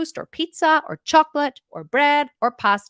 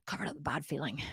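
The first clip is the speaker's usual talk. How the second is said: a sighed, resigned whisper under the breath; sighing whisper; 8 semitones lower, 15 dB quieter than usual. Acted. To the audience, mocking her own excuse: sighing whisper. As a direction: breathe it out with a sigh, almost silent.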